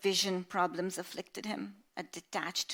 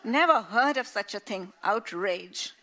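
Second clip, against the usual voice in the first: mock outrage; 3 semitones higher, 8 dB louder than usual. Grandfather scandalised by the college menu, loud indignation. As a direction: scoff at the very idea.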